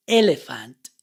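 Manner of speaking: "elephant" with a short open eh. Shown as a demonstration. Teacher modelling "elephant" for learners, short eh. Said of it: "you don't pronounce the vowel E the same way".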